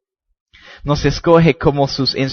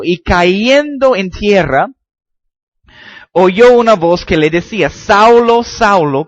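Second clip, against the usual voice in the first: raised, louder preaching read; solemn Bible reading voice; scripture reading; 4 semitones higher, 4 dB louder than usual. Acted. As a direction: proclaim the passage to the congregation. Reads Acts chapter nine aloud.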